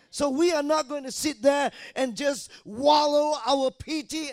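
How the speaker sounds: high, loud shouted preaching; fiery preaching. Acted. Preacher urging the congregation, loud high fiery shout. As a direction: rouse the congregation, full force.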